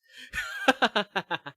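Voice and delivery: loud high laugh; high bright laugh. Real, not acted. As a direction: burst out laughing. Laugh after list.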